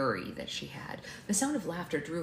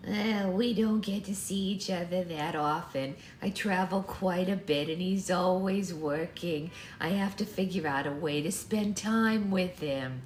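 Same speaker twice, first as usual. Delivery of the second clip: put-on louder, measured old woman; grandma voice; 3 dB louder than usual. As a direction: chat about family as an elderly grandmother. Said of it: "used Toby's voice from the beginning".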